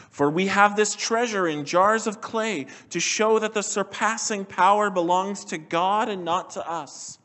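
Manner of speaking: lifted scripture reading, each "but not" line falling. Acted. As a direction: proclaim the passage from the pulpit. Reads scripture passage aloud.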